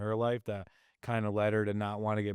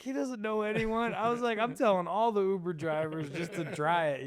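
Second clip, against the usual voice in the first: much higher, giddy retold excitement; excited retelling; 9 semitones higher than usual. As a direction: gush like a giddy newlywed.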